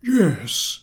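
put-on high, bright old teacher's yes; agree, then steer; agreeing professor. A narrator's reading. Professor agreeing with the boy, lightly voiced. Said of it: "agreed the professor".

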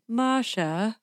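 soft, eerie imitation of a child; hushed mock-creepy calling. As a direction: softly call out like a creepy child at night. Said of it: "came to your room at three o'clock in the morning".

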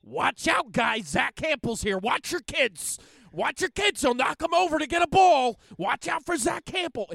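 high, loud Philly yell; Delco accent. Retold or imitated. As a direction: shout a warning in a thick Philly accent. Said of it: "he'll be like".

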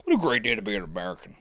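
quick put-on patriotic voice; mock patriotic. Host joking about the great day, mock-patriotic. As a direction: rattle it off as a hammed-up slogan.

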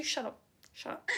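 fading from soft to nearly silent, laughing; fond hush. Retold or imitated. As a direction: hush her lovingly, then trail off. Parents lovingly hushing her.

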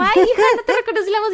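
high, fast mock mom voice; chirpy mom caught out, rattled off fast; imitating mom. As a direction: play her mom, quick and sheepish. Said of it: "y me dijo".

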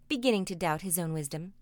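plain reading voice

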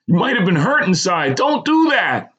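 high-pitched urgent plea; pleading. A narrator's reading. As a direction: plead anxiously, pitch climbing at the end. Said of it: "the same unfamiliar voice plead".

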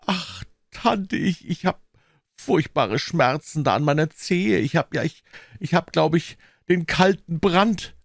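high, halting whimper; hammed-up suffering boy; feigned agony.

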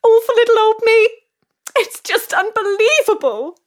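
high, breathless acceptance-speech gush; gushing winner. Acted. Winner gushing to adoring crowd, high and overcome. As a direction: thank the crowd like an overcome star.

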